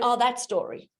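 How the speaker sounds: usual speaking voice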